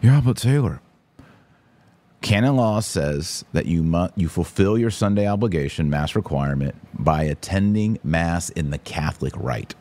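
normal talking voice

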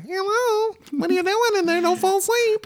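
very high, put-on mother voice; mock mom calling. Mom calls through the bathroom door, high mock mothering. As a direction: sing out in a high mock falsetto.

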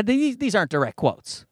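ordinary talk

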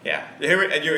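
ordinary talk, nothing marked

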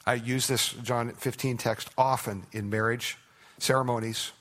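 usual speaking voice

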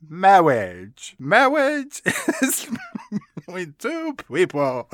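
mock speech-impediment clergyman; hammed-up, lisping wedding officiant; Peter Cook. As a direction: round your r's into w's, drawn out. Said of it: "is when Peter Cook says".

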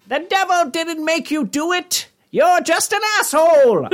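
very high, slow villain screech; Skeletor. Acted. Satan's tweet insulting the reader, high villain screech. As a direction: build to a loud, stretched cartoon insult.

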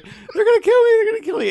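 high, loud mock wail of a kidnapped woman; mock crying. Hostage on the phone to her father, mock sobbing. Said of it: "as she cries and is like".

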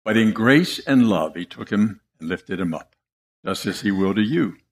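gentle, hushed reassurance; tender, reassuring. A narrator's reading. Preacher telling how Jesus lifted Peter in grace, tenderly. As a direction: drop low and soft after the outburst. Tender turn after the rebuke.

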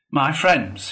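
solemn public address; Badger addressing friends. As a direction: call the company to attention.

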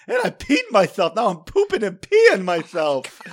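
high, loud whiny villain voice; silly panicked villain. Pretend. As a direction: wail it like a goofy bad guy. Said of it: "bad guy that says".

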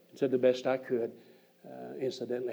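ordinary delivery

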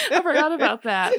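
high, laughing; laughing so hard the voice climbs. Real, not acted. Laughing at the memory.

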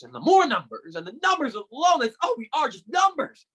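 venting, building on repeated numbers; bitter rant. Acted. Brother rants about students being just numbers, bitter and stammering.

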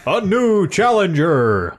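slow, drawn-out game announcer; mock arcade announcer. Fighting game announcer quote.

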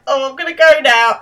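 high, loud mock-nervous excuse; flustered retreat. Simon backing off from the girls, imitated flustered retreat. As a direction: act out an awkward escape.

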